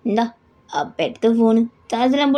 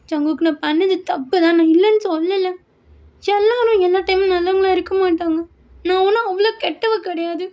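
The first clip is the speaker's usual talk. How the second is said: daughter pleading; 8 semitones higher than usual. Acted. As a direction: press father for the truth, near tears. (உயர்ந்த குரலில் கெஞ்சும் மகள்)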